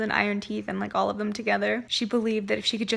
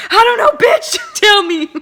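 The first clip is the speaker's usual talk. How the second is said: frustrated yell at the book; shouting; 10 semitones higher, 14 dB louder than usual. Real, not acted. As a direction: yell it out, demanding answers. Reader yelling at the book.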